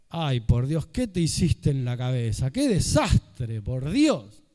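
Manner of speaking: hammed-up horror at a friend's haircut; brutal dramatic friend. Speaker imitates a friend insulting her hairdo, loud mock horror.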